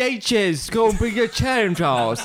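high, slow, botched Irish brogue; terrible Irish accent. Pretend. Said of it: "That's terrible".

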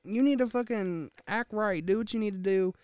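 forceful, exasperated orders, re-enacted; retold exasperated scolding. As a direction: lay down the law, retelling the row. Retold scolding of screaming relative.

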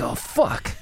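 breathy high mock dismay; dismayed oops. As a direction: realise the mistake with a gasp. Goth dresser at wrong show.

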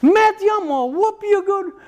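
high-pitched mock threat; imitated wife's threat. Wife copying dad's threats to Matthew, high mock-tough. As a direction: imitate a mom trying out tough talk. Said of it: "and she goes".

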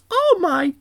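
high, sugary grandma voice; high-pitched chirpy old woman; sweet old lady voice. Retold or imitated. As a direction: play the kindly fabric store lady. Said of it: "[name] goes".

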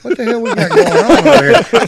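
high, loud imitation of an old woman; startled old woman. Old woman overhearing at the store, mock high-pitched astonishment. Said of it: "little old ninety-year-old woman sitting next to me".

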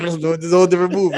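laughing while talking fast; words spilling out through laughter. Real, not acted. Laughing while telling story.

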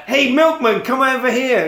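hammed-up hail at only moderate volume; retold calling out. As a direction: call someone over, half-shouting. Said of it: "shout over a milkman".

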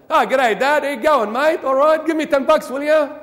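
loud, high, matey Aussie banter; breezy g'day to Dad, pitched well up; informal. Acted. Son asking dad for cash, informal. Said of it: "That's how you speak to dad".

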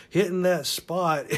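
ordinary talk

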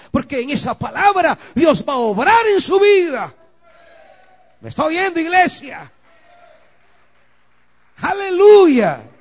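loud, rising preacher's cry; preaching at full force. Acted. Preacher calling the church to treasure the word. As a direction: build to a shouting climax.

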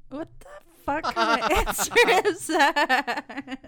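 loud, slow, laughing disbelief; laughing outrage. Real, not acted. Friend scoffs at the cop-out answer, loud and laughing.

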